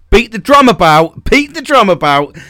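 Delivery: loud, high, drumming up the big reveal; rousing, repeated fanfare for a pet topic; emphatic announcement. Real, not acted. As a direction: proclaim it loudly, pitched right up. Drumroll build-up to his favourite topic.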